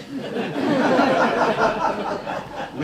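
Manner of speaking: long loud high laughter. Real, not acted. Laughing loudly at his own reply to the owner. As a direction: burst out laughing hard.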